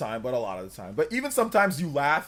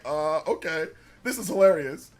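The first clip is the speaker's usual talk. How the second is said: ordinary talk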